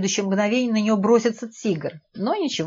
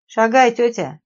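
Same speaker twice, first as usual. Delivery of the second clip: louder, higher, bossy order; raised-voice command; sharp shout; 5 semitones higher, 6 dB louder, slower than usual. A narrator's reading. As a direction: snap it out to hurry someone along. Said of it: "прикрикнула на неё девица".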